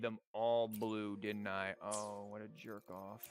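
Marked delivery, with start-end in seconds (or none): none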